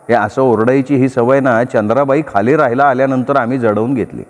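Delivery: plain reading voice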